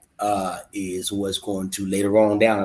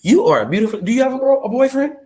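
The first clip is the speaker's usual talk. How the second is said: much higher, bright mock charm to a girl; smooth pickup approach; 12 semitones higher than usual. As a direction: sweet-talk her in a lifted voice. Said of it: "tell her your truth".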